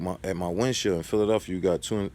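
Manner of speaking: usual speaking voice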